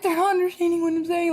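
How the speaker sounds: high, fast whine; mock tearful. Worker in tears to coworker, whining high. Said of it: "I'm in tears".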